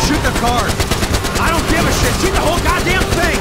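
loud, high, angry yelling; enraged shout at the gunner; furious orders. Acted. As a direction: bark out the order to fire.

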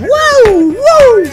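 screaming wow in delight; excited scream. Real, not acted. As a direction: yell with pure hype. Reacting to face cam news.

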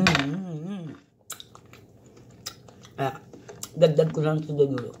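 talking between spoonfuls; while eating. Real, not acted. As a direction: mouth partly full. Seen 1: spoon and mug in hand, bite near 3.5s, chewing after.

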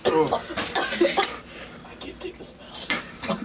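laughing in disgust. Real, not acted. Smell makes him retch.

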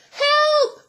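high, loud cry for help; startled cry. A narrator's reading. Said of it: "she cried".

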